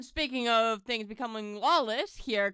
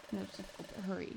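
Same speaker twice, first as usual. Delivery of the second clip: ordinary talk, nothing marked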